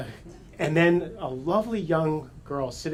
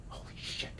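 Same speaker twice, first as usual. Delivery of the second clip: whispered in shock; stunned whisper; 15 dB quieter than usual. Retold or imitated. Narrator realizing the girl could go over the falls, whispered. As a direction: breathe it out, stunned.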